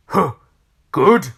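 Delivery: loud, grumpy huff; storybook grump, disgruntled; grumpy scorn. A narrator's reading. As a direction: scoff at the greeting. Mister Grumpy scorns the greeting.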